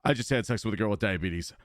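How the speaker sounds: ordinary delivery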